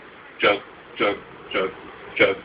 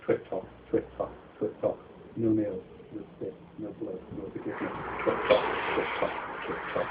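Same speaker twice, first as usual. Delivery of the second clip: whispered bird chirps; 8 dB quieter than usual. Acted. Bird call chant.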